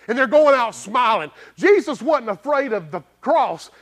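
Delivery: loud, high, driving preacher voice; fiery preaching. Acted. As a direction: preach it hard to the whole church. Preaching at full force.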